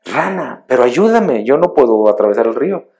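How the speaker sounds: high, loud cry across the river; scorpion calling out. Acted. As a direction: plead with the frog, calling out. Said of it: "le empieza a gritar".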